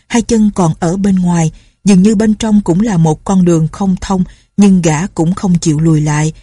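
plain reading voice

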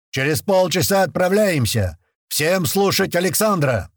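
loud, high parade-ground shout; shouted military order. A narrator's reading. Commander orders the lined-up fighters to listen, shouted order. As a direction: carry the order across the whole formation.

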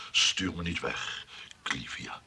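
breathy whispered name, pleading; whispered plea. Acted. Johan begging Clivia not to send him away, whispering.

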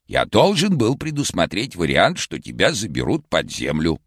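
very high, hoarse cartoon shout; vintage cartoon voice. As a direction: shout it like an old cartoon Karlsson. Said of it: "хриплым, как в старом мультике".

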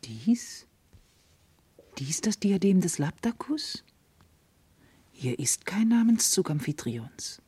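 slow, soft whispered disbelief; astonished whisper. Acted. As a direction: whisper in amazement.

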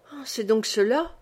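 ordinary delivery, nothing marked

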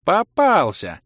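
high, loud gotcha; triumphant. A narrator's reading. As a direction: pounce with glee. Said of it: "голос торжествующе закончил". (торжествующе, звонко)

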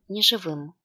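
usual speaking voice

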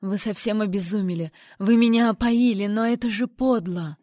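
usual speaking voice

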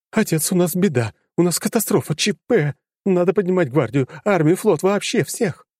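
high, alarmed, pushy daughter; panicked daughter voice. A narrator's reading. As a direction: daughter urgently demanding action. Daughter panics about catastrophe.

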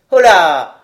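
sharp rise in volume, falling pitch; loud exclamation. A narrator's reading. Villain interrupts the squire's cries, exclaiming loudly. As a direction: call out a long, loud interjection.

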